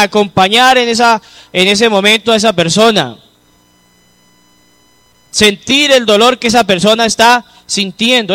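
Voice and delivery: ordinary talk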